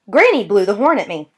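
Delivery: ordinary delivery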